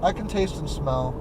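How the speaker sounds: normal talking voice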